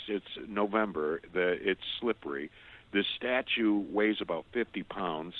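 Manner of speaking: ordinary talk, nothing marked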